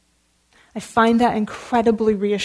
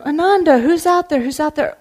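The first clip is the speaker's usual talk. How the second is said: calling out; 6 semitones higher, 5 dB louder than usual. Acted. Buddha calling out to Ananda.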